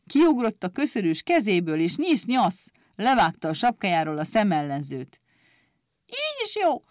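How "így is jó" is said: very high angry squeal; angry cry. A narrator's reading. Clippers snapping back at the grinder in a high angry squeal. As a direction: shriek it in fury. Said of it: "kiáltotta mérgesen".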